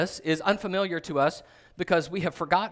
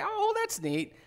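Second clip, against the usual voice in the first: much higher, sing-song indulgent voice; humoring a child; slower than usual. Acted. Adult humoring a tiny child who threatens him, indulgent and amused. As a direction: pat a toddler on the head with your voice.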